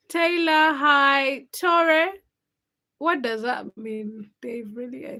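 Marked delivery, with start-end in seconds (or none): 0.1-2.1 loud greetings. Real, not acted. Greeting viewers in the chat.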